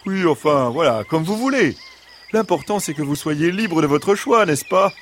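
high-pitched fox brushing off an objection; high, coaxing animal-character voice; smooth-talking fox. Acted. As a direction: wave it away in a high fox voice.

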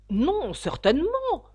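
high, slow, loud indignant denial. A narrator's reading. Alice answers the washing question, indignant. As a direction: deny it with offended emphasis. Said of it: "dit Alice indignée".

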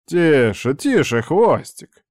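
calm her down with a teasing hush; placating. A narrator's reading. Uncle surrenders to his angry niece, placating. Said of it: "поднял руки в жесте капитуляции".

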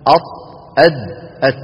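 أط heavy, then أد and أت light; contrasting letter demo. Shown as a demonstration. Teacher contrasting the three letters for students. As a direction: sound out أط، أد، أت one by one. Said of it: "لاحظوا بارك الله فيكم".

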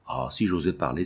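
soft, low and sighing, held-back words. Acted. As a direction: sigh the line out as if afraid to speak. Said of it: "entre deux soupirs".

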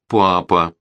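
plain reading voice

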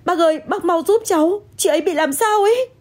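high-pitched, urgent begging; panicked plea. Acted. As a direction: plead frantically for help. Begging guard to help injured woman.

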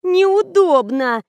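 high, drawn-out whine of a spoiled model; капризно. A narrator's reading. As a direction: whine it slowly, pitched well up. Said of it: "капризничала модель". (капризное нытьё, высоко и протяжно)

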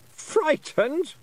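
very high queenly disbelief; incredulous Queen. A narrator's reading. Queen answering the girl's challenge, high incredulous voice. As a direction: echo it as a scoffing queen, pitched right up. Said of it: "said the voice of the Queen".

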